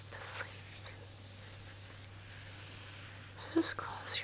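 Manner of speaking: fading sleepy whisper, slowing down; soft spa whisper. Acted. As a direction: lull them to sleep in a hush. Lulling viewer to sleep.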